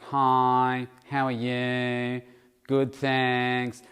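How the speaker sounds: slow, drawn-out, annoying cashier drone; loud monotone with every word stretched out; dull, annoying voice. Retold or imitated. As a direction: mimic a loud, dull checkout greeting. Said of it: "very dull, kind of annoying voice".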